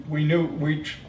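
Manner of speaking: normal talking voice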